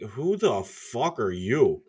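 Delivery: slow, drawn-out disbelief; retold slow-motion "who are you"; incredulous challenge. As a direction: stretch it out, baffled by this stranger. Retells confronting a stranger at bar.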